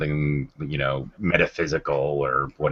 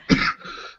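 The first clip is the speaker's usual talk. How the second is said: choking cough; coughing fit. Real, not acted. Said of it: "when I'm not choking to death here".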